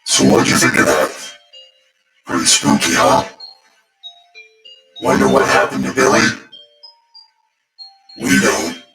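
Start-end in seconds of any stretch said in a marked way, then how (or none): none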